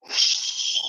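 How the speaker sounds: soft unvoiced hiss like a cat. Cat warning off the dog with a soft hiss. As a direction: hiss it out softly. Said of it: "the cat went".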